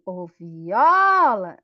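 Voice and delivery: "Ô, viola" called loudly with a hugely stretched "o" in "viola"; calling the word. Shown as a demonstration. Teacher calling the word to find its stressed syllable, drawn-out call. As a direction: call out "Ô, viola", holding the stressed O long and loud. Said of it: "você demorou mais no O".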